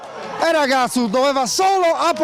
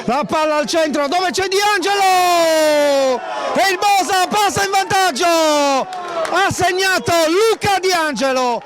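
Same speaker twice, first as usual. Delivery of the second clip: breathless italian goal commentary; 7 semitones higher than usual. Real, not acted. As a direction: shout the goal with everything. Bosa scores the opening goal.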